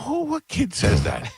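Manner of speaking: high, slow mock disbelief; dad scoffing at his daughter; incredulous. Dad mocking daughter's refusal.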